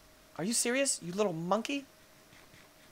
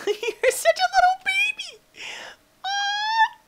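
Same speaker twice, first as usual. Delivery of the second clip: very high squeaky coo with a laugh; high squeaky baby-talk teasing; 5 dB louder, slower than usual. Pretend. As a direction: coo at a tiny baby animal. Cooing at baby dino.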